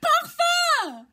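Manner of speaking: high, loud exclamation; loud cry. A narrator's reading. Marquise leaping from the board cries her wish, high shout. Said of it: "s'écrie".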